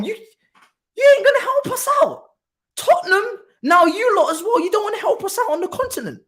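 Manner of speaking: very high squeaky mock complaint through laughter; mock outrage. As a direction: whine in a silly high voice.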